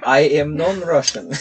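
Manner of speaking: mock Russian accent for a laugh; bad Russian accent. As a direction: put on a jokey Russian accent. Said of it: "We pulled out the bad accents".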